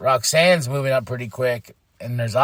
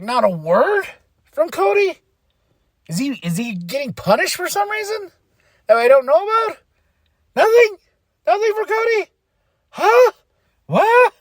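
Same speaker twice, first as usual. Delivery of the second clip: high, squeaky outraged questions; indignant disbelief; 4 dB louder than usual. Retold or imitated. Fan protesting Cody's silenced segment, indignant disbelief. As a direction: climb higher and louder, incredulous. Mock outrage at Cody being sidelined.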